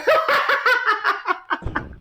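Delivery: sudden high-pitched burst of laughter. Real, not acted. Streamer reacting to a friend's confession, high laugh. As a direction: cackle at the news.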